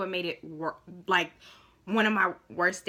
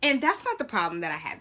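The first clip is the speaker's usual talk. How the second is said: ordinary talk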